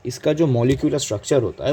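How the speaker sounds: ordinary talk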